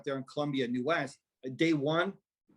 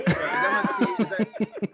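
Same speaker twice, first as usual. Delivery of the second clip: words breaking up in high laughter; laughing too hard to finish; 5 dB louder, much slower than usual. Real, not acted. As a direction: laugh your way through. Laughing at orgy joke.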